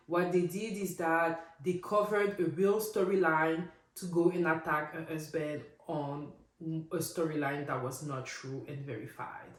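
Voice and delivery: usual speaking voice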